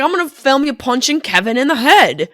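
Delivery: lightly put-on Australian accent. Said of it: "and he's from Australia".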